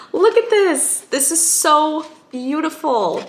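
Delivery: high-pitched, loud marvelling; gushing delight. Real, not acted. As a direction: gush over it, stretching the words. Showing off the gift bookmark.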